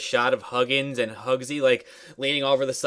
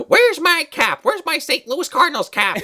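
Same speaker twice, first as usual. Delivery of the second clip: high, strained put-on boy voice; whiny boy; 4 dB louder than usual. Grandson in his robe hunts his cap, high mock whine. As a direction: wail it like a cartoon kid.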